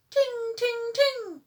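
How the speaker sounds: very high, sung ding ding. A narrator's reading. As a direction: chime it like a tiny bell. The mystery bell sound.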